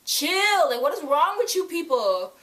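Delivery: hammed-up exasperated scolding; exasperated mock shout. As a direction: scold the fans to calm down. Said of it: "I was like".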